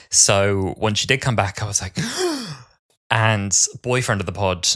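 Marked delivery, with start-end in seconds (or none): 2.0-2.5 sharp, high mock gasp; shocked gasp. Podcaster re-enacting Susan's return reaction, shocked gasp. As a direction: gasp in amazement.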